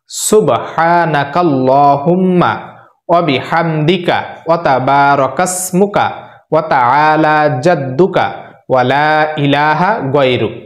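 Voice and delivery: slow, syllable by syllable Arabic prayer recitation; slow careful recitation. Shown as a demonstration. Teacher reciting Sana to viewers, slow and careful. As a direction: recite সুবহানাকাল্লাহুম্মা slowly so learners can copy. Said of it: "আমি একটা দোয়া দুইবার করে বলবো".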